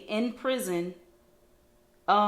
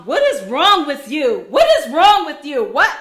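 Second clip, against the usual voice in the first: loud, higher mock outrage; spicy outburst; 5 semitones higher, 12 dB louder than usual. Man challenges the mother recording him, imitated spicy outburst. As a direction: imitate an exasperated man, punching the words. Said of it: "The guy is a little spicy".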